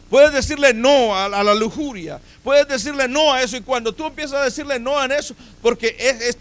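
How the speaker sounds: forceful pulpit exhortation; impassioned preaching. Real, not acted. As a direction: preach it high and loud, driving the point home.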